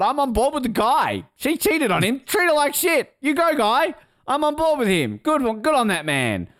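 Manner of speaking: high-pitched, emphatic cheering on; root for him in a high, insistent voice; rallying support. Acted. Podcaster cheering on a story's wronged man, rallying support. Mock cheering for a cuckolded character.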